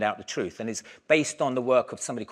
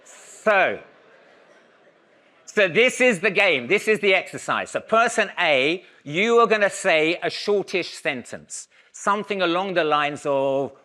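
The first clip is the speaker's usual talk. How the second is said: raised, higher voice calling instructions to the class; projecting to a room; 6 semitones higher, 6 dB louder than usual. Acted. Lecturer calling out instructions to the audience, projected and raised. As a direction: project instructions across a hall.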